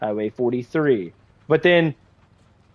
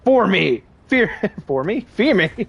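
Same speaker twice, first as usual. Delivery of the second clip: mock villain taunt; 5 semitones higher than usual. Joking game taunt.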